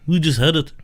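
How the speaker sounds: put-on half-hearted Liverpool accent, hamming it up lightly. Joking with his co-host about the band, a faint bad Liverpool accent. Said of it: "sounds like a bad [name] impression".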